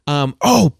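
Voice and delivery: ordinary delivery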